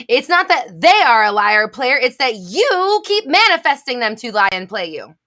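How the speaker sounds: frustrated rant at a viewer who missed the point, loud. Real, not acted. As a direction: hammer the point home at a viewer. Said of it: "Sometimes after I scream things".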